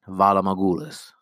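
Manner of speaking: a light stab at a Braavosi accent, words said carefully; lightly put-on Braavosi accent on Valar Morghulis; attempted Braavosi accent. Shown as a demonstration. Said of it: "A nice accent, right?".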